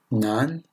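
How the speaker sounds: Nan, rising second tone repeated. Shown as a demonstration. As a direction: start Nan mid and climb upward. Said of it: "on part du milieu et on monte vers les aigus".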